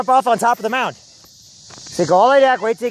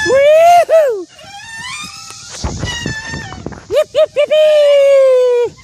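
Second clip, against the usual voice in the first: very high, loud yapping whoops; yappy chihuahua voice; 4 dB louder, much slower than usual. Acted. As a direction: yip like a tiny excited dog. High yipping whoops while playing.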